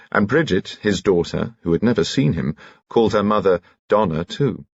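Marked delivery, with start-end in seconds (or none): none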